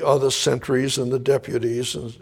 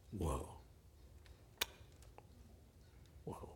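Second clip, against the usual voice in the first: hushed awe; 6 semitones lower, 10 dB quieter than usual. Real, not acted. Preacher moved by Peter's weeping.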